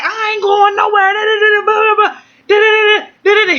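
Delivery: very high, insistent rant tailing into nonsense syllables; mock ranting. Pregnant woman talking over her partner, mock high rant. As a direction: argue back high and heated, re-enacted.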